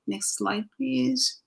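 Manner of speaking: usual speaking voice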